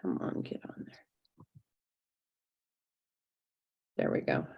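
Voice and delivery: whispered to herself while fiddling with the paper; muttered under breath. Real, not acted. Coaxing paper into place.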